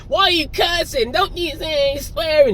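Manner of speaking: a hint of a prissy complainer, drawn out; mock prissy critic. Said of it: "If you get".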